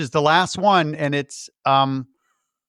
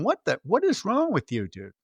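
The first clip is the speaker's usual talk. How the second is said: high, softer incredulous questioning; mock exasperation; 4 semitones higher, 6 dB quieter than usual. Imitating Bert's wife questioning his bomb plan, mock exasperation. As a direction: swing the pitch up in disbelief, playfully. Wife's exasperated reaction, retold.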